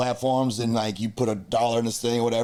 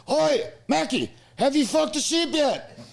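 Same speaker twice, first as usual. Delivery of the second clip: high, slow mock Irish lad shout; high, slow hammed-up Irish lad voice. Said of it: "they're like".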